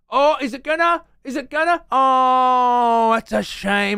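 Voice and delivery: high, loud excited presenter; hammed-up game show host. Mocking the quiz host cheering the coin, high and loud. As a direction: hype the coin drop like a TV host. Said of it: "the host presenter's getting into it".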